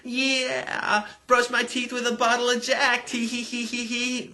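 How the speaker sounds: high-pitched squealing party fan; mock giddy squeal ending in a tee-hee; mock excited scream. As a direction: squeal it like a giddy party girl, drawing out the words. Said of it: "and they scream".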